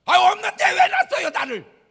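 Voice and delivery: very high, loud bratty yell; rude shouting. Acted. A spoiled son screams at his father for money. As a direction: scream at your father insolently. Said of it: "싸가지 없는 놈".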